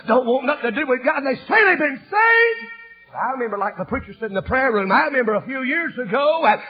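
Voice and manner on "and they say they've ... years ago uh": high, loud crying preacher shout; full-force sermon wail; shouted preaching. Acted. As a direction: wail it out over the music.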